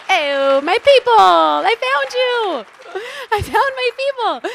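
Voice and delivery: delighted high-pitched squeal of recognition; excited squeal. Real, not acted. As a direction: gush like you just found your long-lost tribe. Joyful cry to kindred audience.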